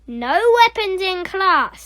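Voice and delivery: loud, high, stern rebuke; sharp teacher's reprimand; snapped. Acted. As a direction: snap the rule at a pupil. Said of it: "snapped Miss Boot".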